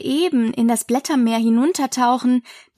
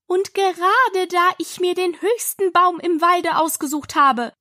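higher-pitched, mildly heated pigeon; raised voice; 7 semitones higher than usual. A narrator's reading. Pigeon complains about her tree, voice raised. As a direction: lift the pitch in aggrieved protest. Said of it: "mit erhobener Stimme".

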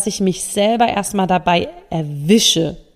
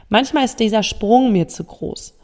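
ordinary talk, nothing marked

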